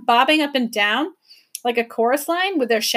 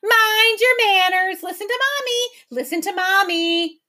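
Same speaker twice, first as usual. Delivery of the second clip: mocking creatures; 9 semitones higher than usual. A narrator's reading. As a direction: mimic the bird gleefully. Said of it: "rolled on the ground in delight".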